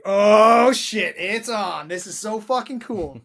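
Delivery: loud, high, thrilled reaction; excited outburst. Retold or imitated. Film fan reliving the moment the music hits, excited. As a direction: burst out loudly in thrilled awe. Acting own thrill at the scene.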